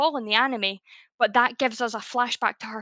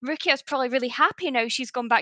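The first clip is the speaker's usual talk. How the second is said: mocking Orihime; 3 semitones higher than usual. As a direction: parody her as simpering and self-centred. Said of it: "Orihime starts going".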